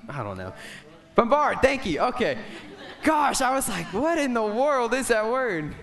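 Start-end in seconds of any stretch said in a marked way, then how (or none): 1.1-5.7 loud, high, laughing relief; relieved outburst. Real, not acted. Preacher thanking someone for the forgotten word, relieved and laughing. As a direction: burst out as the lost word finally comes.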